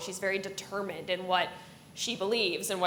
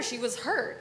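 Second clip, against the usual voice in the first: usual speaking voice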